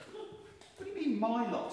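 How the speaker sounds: very high, indignant query; affronted king. Acted. King challenges his minister's jibe.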